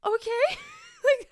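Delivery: high, slow laughing okay. Real, not acted. Teacher laughing at the second grader's story. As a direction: lose it mid-word.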